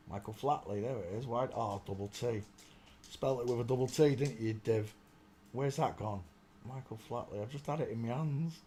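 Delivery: ordinary talk, nothing marked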